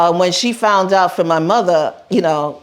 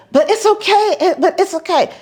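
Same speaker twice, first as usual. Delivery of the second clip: high pleading voice; pleading Madame; 12 semitones higher than usual. Retold or imitated. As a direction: coax him, voice up high. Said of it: "Madam was saying".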